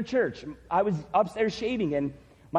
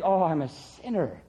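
mopey self-pity; 5 semitones lower than usual. Retold or imitated.

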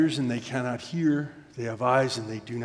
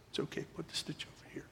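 quick near-whisper of reassurance; imitated hushed surgeon; whispering mentor; 3 semitones higher, 14 dB quieter, faster than usual. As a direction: murmur guidance close to the ear. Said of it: "we are whispering back and forth".

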